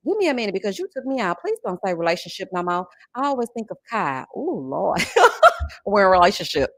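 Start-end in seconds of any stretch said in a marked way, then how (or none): 5.1-5.8 sudden high laugh; loud high cackle; high burst of laughter. Real, not acted. Laughing at chat comment.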